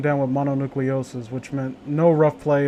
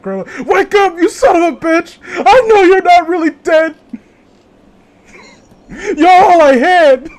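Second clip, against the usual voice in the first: mock grief yell, high and loud; screaming bully; 13 semitones higher, 10 dB louder than usual. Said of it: "He's like".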